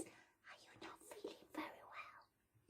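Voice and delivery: a gentle oil spirit whispering softly; whispery Monarda voice. Acted. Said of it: "And she goes".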